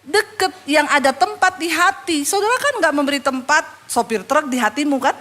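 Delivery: ordinary talk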